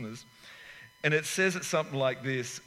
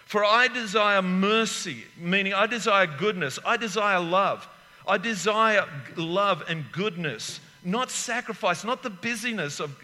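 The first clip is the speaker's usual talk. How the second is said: raised preaching voice; 4 semitones higher, 4 dB louder than usual. Acted. Preacher quoting scripture to the congregation, raised voice. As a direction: proclaim the verse to the church.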